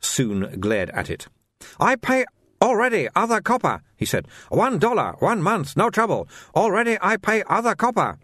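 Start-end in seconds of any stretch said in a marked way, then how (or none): 1.8-3.8 high-pitched, sing-song sales patter in broken English; haggling like an eager shopkeeper; pushy shopkeeper Soon. Acted. Soon voiced again. 4.5-8.2 high-pitched, sing-song sales patter in broken English; haggling like an eager shopkeeper; pushy shopkeeper Soon. Acted. Soon voiced again.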